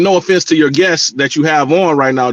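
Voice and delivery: ordinary delivery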